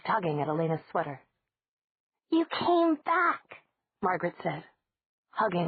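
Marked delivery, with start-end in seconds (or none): 2.3-3.6 put-on high, slow happy child; a small child, delighted; little girl voice. A narrator's reading. Four-year-old greets Elena.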